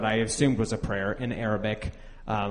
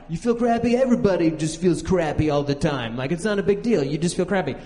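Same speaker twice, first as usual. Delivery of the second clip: high, louder scoffing brush-off; dismissive doctor; 5 semitones higher, 3 dB louder than usual. Retold or imitated. Doctor shrugging off a patient's cold, mock dismissive. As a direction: wave it away like it's nothing. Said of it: "I thought he meant, like".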